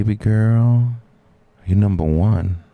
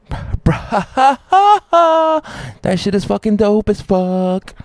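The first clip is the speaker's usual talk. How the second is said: much higher excited gush; thrilled, pitched way up; 13 semitones higher, slower than usual. Real, not acted. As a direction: hype it up, voice jumping up. Excited about Scorpion.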